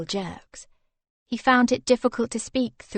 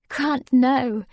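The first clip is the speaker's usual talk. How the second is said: flustered mock scold, a little louder; embarrassed scolding; 4 semitones higher, 5 dB louder than usual. Wife on his lap scolds her husband, flustered. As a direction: protest while half laughing. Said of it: "she scolded with a discomfited laugh".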